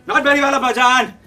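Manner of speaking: eager, loud outpouring, building; excited confession. Acted. Son telling father he wants to act, excited. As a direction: blurt out the dream with rising enthusiasm.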